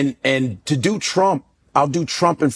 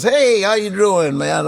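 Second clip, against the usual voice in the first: Cosby impression; 6 semitones higher, slower than usual. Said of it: "And he goes".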